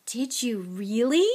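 slow, delighted question, swooping up; eager. A narrator's reading. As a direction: savour it with boyish fascination. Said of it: "said Mister Weasley eagerly".

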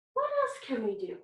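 ordinary talk, nothing marked

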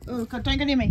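loud frightened plea; panicked. Real, not acted. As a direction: keep it away from me, scared. Afraid of the spider.

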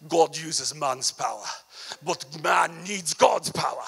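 lightly hammed-up German accent. Quoting Reinhard Bonnke in a mild mock German accent. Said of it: "I should say it with a German accent".

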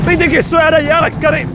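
high, loud regretful yell; yelling mid-ride. Real, not acted. Rider yelling about the dessert while spinning. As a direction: shout the regret over the wind.

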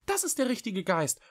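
high-pitched small cat voice; praising little cat. A narrator's reading. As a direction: cheer the idea on in a high voice. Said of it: "lobte Echo". (hohe Katerstimme, lobend)

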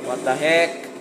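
normal talking voice

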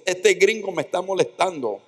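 put-on high, tattling girl's voice; complaining girl. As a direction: tattle on the new kid.

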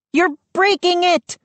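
very high, urgent cry; alarmed shriek. A narrator's reading. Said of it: "Mindy shrieked".

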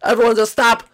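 loud high panicked shouts; frantic shouting. Acted. Yoshi screaming at the crowd to stop.

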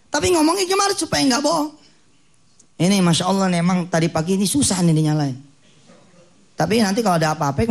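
ordinary talk

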